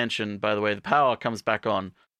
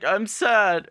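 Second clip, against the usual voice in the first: 1 mock sad; 8 semitones higher, 3 dB louder than usual. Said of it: "she's like".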